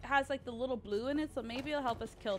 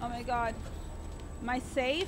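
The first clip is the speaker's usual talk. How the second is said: panicked; 3 semitones higher, 4 dB louder than usual. Real, not acted. Hiding from monster, still panicking.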